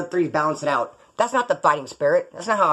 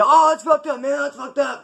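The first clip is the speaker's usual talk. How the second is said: high-pitched mock complaint, loud at the start; throwing a fit; 9 semitones higher, 3 dB louder than usual. Imitating a prison acquaintance griping about weed, throwing a fit. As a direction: imitate someone crying foul in a big fit. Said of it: "Throw, like, a big old fit".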